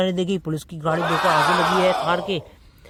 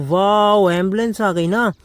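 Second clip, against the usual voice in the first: ordinary talk, nothing marked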